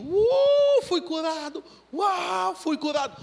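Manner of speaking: high whoop then healed; joyful exclaim. Retold or imitated. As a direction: whoop with joy. Arrives, still laughing, healed. (exclamação de alegria)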